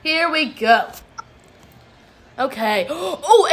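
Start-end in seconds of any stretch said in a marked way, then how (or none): 2.9-3.5 surprised. Real, not acted. Gasp on remembering a match.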